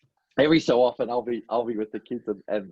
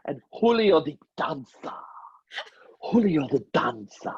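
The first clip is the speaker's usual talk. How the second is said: hammy put-on dancer voice; stretched, lightly accented showman; Julio; 4 semitones higher than usual. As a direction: announce the dancer with a flourish. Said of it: "How about if Julio comes out?".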